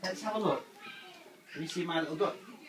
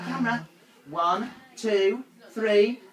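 excited countdown; 3 semitones higher, 9 dB louder, slower than usual. Real, not acted. Counting down for a photo.

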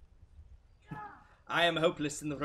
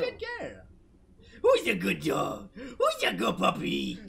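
talking to a pet; 2 semitones higher, 4 dB louder, slower than usual. Pretend. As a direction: coo it at a dog, stretched out. Praising the dog companion.

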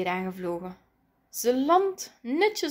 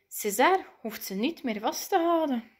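usual speaking voice

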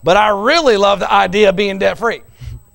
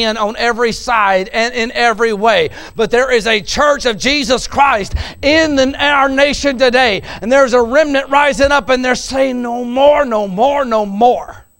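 loud, higher, building preacher's cry; fiery preaching; 4 semitones higher, 5 dB louder than usual. Acted. Preacher to congregation, loud fiery sermon climax. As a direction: preach it out to the congregation at full force.

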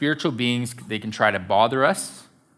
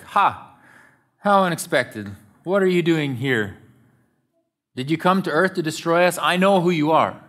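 higher-pitched demon's taunt, acted out; mocking cry; 5 semitones higher than usual. Demon heckles Jesus during his teaching, mocking cry. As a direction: cry out in mock surprise at an intruder. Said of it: "he cries out".

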